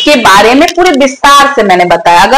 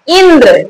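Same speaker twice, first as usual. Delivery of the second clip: "Indra" with the opening इ pressed, falling after it; stress on first vowel. Shown as a demonstration. Teacher pointing out the stressed letter, stressed "Indra". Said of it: "इन दो अक्षरों पर जोर पड़ रहा है".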